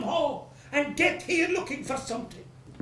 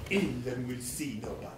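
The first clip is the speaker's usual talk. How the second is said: much lower and softer sneering echo of "Ill and will see nobody"; mocking echo; 13 semitones lower, 8 dB quieter than usual. Creditor sneeringly echoing the maid's excuse, much lower. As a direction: growl her words back with contempt.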